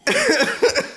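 high giggle breaking out; high laugh. Real, not acted. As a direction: laugh at his own accent. Laughs at own bad accent.

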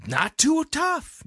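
high, slow, drawn-out emphasis. Shown as a demonstration. Repeats phrase with emphasis.

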